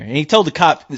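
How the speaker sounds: ordinary delivery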